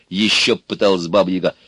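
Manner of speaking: plain reading voice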